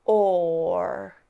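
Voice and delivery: "or" ending with tongue curled back and up; drawn-out rhotic "or" with a clear er sound. Shown as a demonstration. Teacher practises the word or for learners, rhotic R. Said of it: "the tongue needs to move backwards".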